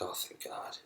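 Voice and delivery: hushed, voiceless sigh of relief; relieved whisper to oneself. Acted. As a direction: breathe it out under the breath, relieved. Acting out momentary relief.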